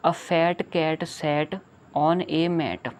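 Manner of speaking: each word set apart, very slowly; very slow reading. Shown as a demonstration. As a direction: read it so a child can follow. Reading poem line to children.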